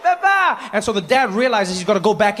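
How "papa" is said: put-on high scared kid crying Papa; scared child calling. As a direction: call out like a frightened kid. Child calling his father.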